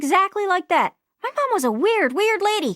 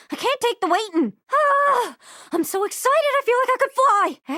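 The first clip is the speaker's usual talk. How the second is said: usual speaking voice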